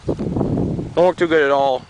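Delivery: "Don't work too good at all" drawn out slowly for emphasis; slow repeat. Real, not acted. Repeats complaint about plow.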